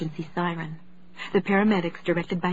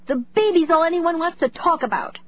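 put-on high, upset teenage girl; upset cry; 9 semitones higher, 5 dB louder than usual. A narrator's reading. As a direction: complain loudly, fed up. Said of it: "Zoe cried".

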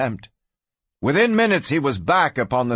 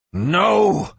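sudden higher, forceful "no"; forceful outburst; 6 semitones higher, 3 dB louder than usual. A narrator's reading. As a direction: burst out in sharp refusal. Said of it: "it surprised him with its force".

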